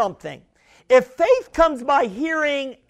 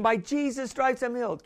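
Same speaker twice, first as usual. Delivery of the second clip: small; 8 dB quieter than usual. Retold or imitated. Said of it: "then you keep speaking".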